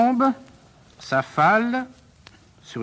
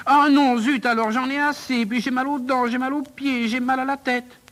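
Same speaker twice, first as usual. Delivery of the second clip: fed-up pupil listing aches; exasperated whining; 8 semitones higher than usual. Acted. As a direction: whine in protest, pitched high. Pupil revolts, complains of pains.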